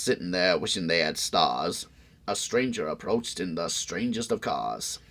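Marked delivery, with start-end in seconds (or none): none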